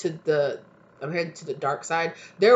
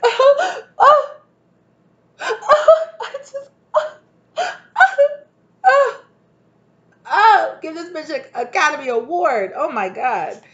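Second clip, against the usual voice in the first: very high, loud hammed-up wails; mock melodramatic sobbing; fake crying; 12 semitones higher, 9 dB louder, slower than usual. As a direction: overact a sobbing movie scene. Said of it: "fake crying".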